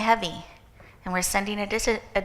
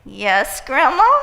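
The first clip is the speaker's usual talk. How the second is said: high, loud eager "Yes, Grandma?"; dutiful grandchild; 4 semitones higher, 8 dB louder, slower than usual. Retold or imitated. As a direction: play the obliging grandchild. Answering the grandmother's guilt.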